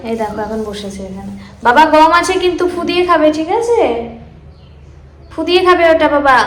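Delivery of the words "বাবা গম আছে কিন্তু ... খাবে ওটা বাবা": coaxing instruction. Real, not acted. Mother telling baby to blow on the food, louder coaxing. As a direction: tell the little one firmly but kindly.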